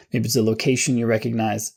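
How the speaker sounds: ordinary talk, nothing marked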